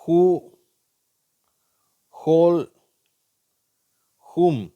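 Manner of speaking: "ஹூ, ஹோல், ஹூம்" with h and silent w, spaced apart. Shown as a demonstration. Teacher showing silent w, "ஹூ, ஹோல், ஹூம்" starting with h. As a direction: model "ஹூ, ஹோல், ஹூம்" loudly, each starting on h. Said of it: "ஹ்ன்ற சவுண்டை".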